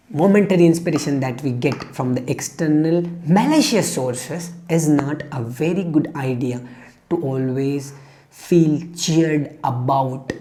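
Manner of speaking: normal talking voice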